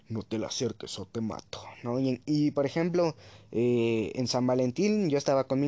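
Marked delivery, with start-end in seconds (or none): 0.1-1.6 put-on low, soft threatening voice of the jealous girlfriend; menacing quote. Girlfriend warning off a rival, low quiet menace. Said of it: "Rochely actuaba así como de".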